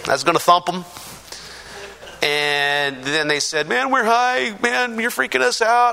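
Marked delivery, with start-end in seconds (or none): 3.7-5.9 higher-pitched imitation of stoned guys; mock stoner complaint, pitched up; loaded druggies. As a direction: whine it like someone high and freaked out. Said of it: "all loaded".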